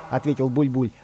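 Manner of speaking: plain reading voice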